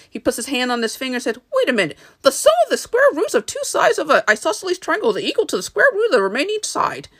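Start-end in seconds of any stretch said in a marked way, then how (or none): 1.5-7.1 high, fast excited recital; breakneck, high-voiced know-it-all; Scarecrow. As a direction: rattle it off in a pitched-up character voice. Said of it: "then the Scarecrow".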